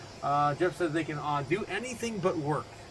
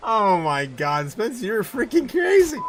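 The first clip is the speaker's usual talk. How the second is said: laughing; 7 semitones higher, 9 dB louder, slower than usual. Real, not acted. Laughing at chat message.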